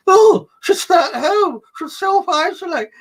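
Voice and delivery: high, loud mock-panicked cry; shrill-pitched hammed-up horror; alarmed bystander. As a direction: mimic a fussing onlooker in alarm. Mimicking alarmed people avoiding sufferers.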